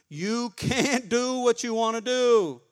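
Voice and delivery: slow call for the church to echo; slow it down so they can say it back; slow call-and-response prompt; congregation repeat-after-me line. Shown as a demonstration. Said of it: "Repeat after me".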